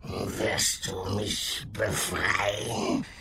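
slow, gurgling hissing creature; basilisk. Acted. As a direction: draw it out like a beast. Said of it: "gurgelnde, zischelnde Stimme".

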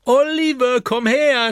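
hoch und sehr laut rufen; Schrei. Acted. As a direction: ruft quer übers Schiff. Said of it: "schreit Püppi". (Püppi ruft Oliver herbei, laut schreiend)

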